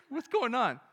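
ordinary talk, nothing marked

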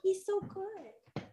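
high, soft cooing. Real, not acted. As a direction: coo at the pet. Cooing over the cat.

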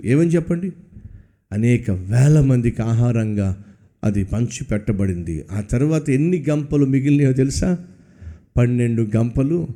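ordinary delivery

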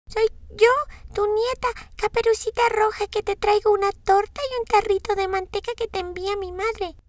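voz aguda e infantil de niña. A narrator's reading. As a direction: una niña inocente, alta y dulce. Voz de Caperucita. (voz de niña pequeña)

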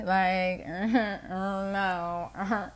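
ordinary talk, nothing marked

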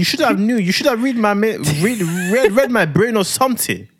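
high-pitched, exaggerated African-accented scolding; mock African accent. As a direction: mimic an outraged wife with an African accent. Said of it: "puts on an African accent when he's mimicking me".

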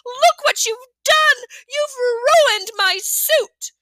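high, loud and slow outrage; woman scolding Fudge over her ruined suit; furious cry. A narrator's reading. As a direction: scold the boy in fury. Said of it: "she cried".

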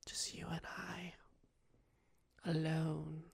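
breathy whisper, very soft; seductive whisper. Acted. Character trying to seduce the sheriff, whispered.

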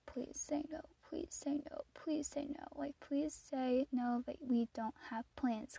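soft whispered please say no; whispered pleading. Retold or imitated. Hoping dad has no plans, whispering please. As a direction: beg under your breath.

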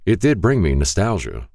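ordinary delivery, nothing marked

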